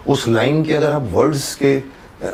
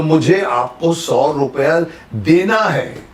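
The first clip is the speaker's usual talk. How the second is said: very slow, देना pressed and falling; stress on देना; 4 semitones higher, much slower than usual. Shown as a demonstration. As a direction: insist on देना, I owe it. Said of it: "stress comes on देना".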